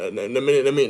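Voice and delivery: usual speaking voice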